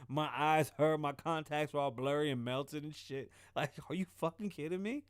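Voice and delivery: usual speaking voice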